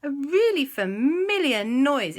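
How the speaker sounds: high put-on musing voice; puzzled musing. Andy recalling a familiar noise, high puzzled voice.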